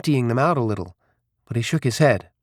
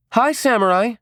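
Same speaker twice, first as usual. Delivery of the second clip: high-pitched call to someone nearby; 12 semitones higher, 3 dB louder than usual. A narrator's reading. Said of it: "she called out".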